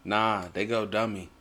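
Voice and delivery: ordinary talk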